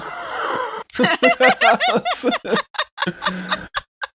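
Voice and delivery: high squealing laugh that won't stop; helpless giggling fit at yourself; shrieking laugh, doubled over; burst of real laughter. Real, not acted. Player cracks up at own mistake.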